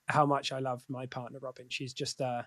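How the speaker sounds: soft, low and halting with emotion; quietly moved, searching for words; getting emotional. Real, not acted. Speaker telling of love for a partner, getting emotional. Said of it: "getting emotional talking about it".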